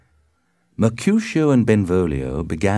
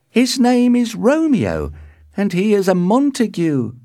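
high, loud nurse; very high, emphatic servant; nurse's voice; 5 dB louder than usual. A narrator's reading. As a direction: inform her in a high, carrying voice. Nurse answers in character voice.

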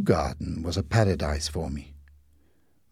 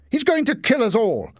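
high, loud accusing shout; panicked high-pitched cry of blame; 12 semitones higher, 4 dB louder than usual. A narrator's reading. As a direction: shout it out in alarm. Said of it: "shouted Larry".

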